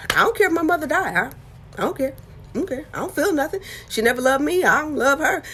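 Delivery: put-on echoing old denial; cold denial. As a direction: quote her old bitterness, hurried. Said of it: "I used to always say".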